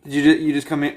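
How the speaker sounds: ordinary delivery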